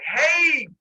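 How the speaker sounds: high, loud drawn-out hail; calling down. Retold or imitated. Zeus goes back to the edge of the pit, loud call. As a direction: holler it down into a pit.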